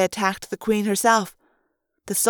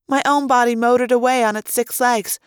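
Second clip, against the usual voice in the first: ordinary delivery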